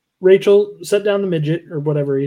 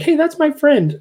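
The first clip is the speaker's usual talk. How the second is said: put-on much higher voice for the girl; mock girl's voice; 11 semitones higher than usual. The girl defending her friend, high mock voice. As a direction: pipe up for a friend.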